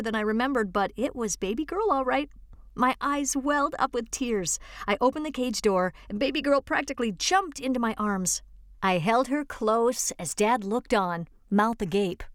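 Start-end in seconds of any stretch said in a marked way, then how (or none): none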